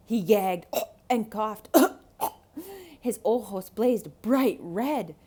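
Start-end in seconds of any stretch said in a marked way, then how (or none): 0.9-5.1 loud choking coughs; coughing. Acted. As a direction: cough like a burning throat. Wolf gags on pepper.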